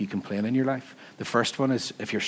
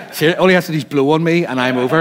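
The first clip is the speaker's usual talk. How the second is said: higher and louder, laughing through the words; half laugh it, scared of the big lad; laughing nervously; 6 semitones higher, 10 dB louder than usual. Retold or imitated. Speaker imagining the big lad knocking him over, nervous laughing.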